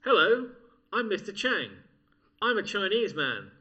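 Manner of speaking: deliberately unconvincing impression in a British accent. Comic's failed impression for a co-host, very British accent. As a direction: announce yourself loudly, line by line, in plain British. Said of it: "did that in a very British accent".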